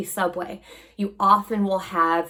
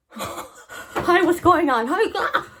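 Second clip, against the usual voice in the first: frazzled laughing greeting; 9 semitones higher, slower than usual. Retold or imitated. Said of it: "I was just like".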